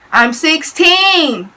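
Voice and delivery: full-force high yell, drawn out. Retold or imitated. Teen to the man hitting on her, loud drawn-out yell. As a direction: yell it slowly, stretching the words. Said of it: "I wanted to yell that".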